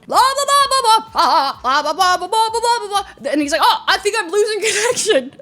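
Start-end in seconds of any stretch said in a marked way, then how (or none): 0.1-3.3 high-pitched nonstop blah-blah babble; mock yapping. Loud man yapping the entire flight, mock yapping. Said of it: "he was yapping". 3.6-5.4 high, put-on caller's voice; loudmouth on phone. Imitating loud man on his phone.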